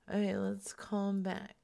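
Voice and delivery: ordinary delivery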